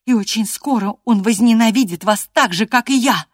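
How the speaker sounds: high, loud taunt; defiant cry. A narrator's reading. Catherine predicts Gerton will hate the master, crying out. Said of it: "крикнула Кэтрин".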